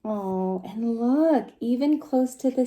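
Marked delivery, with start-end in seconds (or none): none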